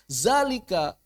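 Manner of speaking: "Zalika" with a buzzing initial z; mispronounced dh as z. Retold or imitated. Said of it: "Kok zalika?".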